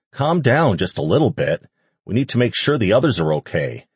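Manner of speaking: usual speaking voice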